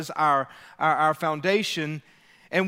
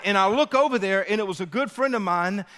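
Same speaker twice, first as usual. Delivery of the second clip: ordinary talk, nothing marked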